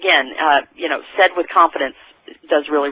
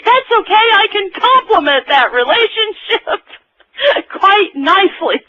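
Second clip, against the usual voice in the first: hammed-up flirt; 4 dB louder than usual. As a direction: play a cheeky smooth talker. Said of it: "Or finally somebody says".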